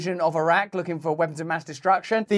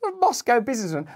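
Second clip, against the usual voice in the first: higher and faster, mocking disbelief; incredulous scoff; 8 semitones higher, faster than usual. Real, not acted. Host mocking a newspaper's vague source, incredulous. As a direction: repeat it like it's absurd. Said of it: "that's not a good enough source".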